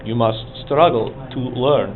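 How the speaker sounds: softly spoken mock Russian accent; hushed, playful Russian-accented line. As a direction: put on a quiet Russian accent. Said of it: "a Russian accent the whole class".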